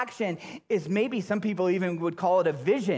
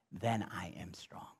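whisper; 12 semitones lower, 14 dB quieter than usual. Acted. Scripture reading, sudden hush.

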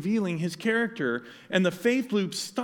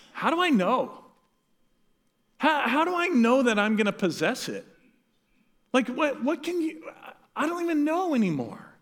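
flustered questioning; 5 semitones higher than usual. Retold or imitated. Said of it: "It's almost like Abram's flustered".

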